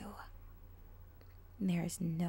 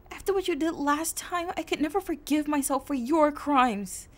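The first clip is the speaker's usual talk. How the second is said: higher, louder pained accusation; anguished confrontation; 7 semitones higher, 10 dB louder than usual. Acted. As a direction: hurl the questions in despair. Heroine confronts villain in anguish.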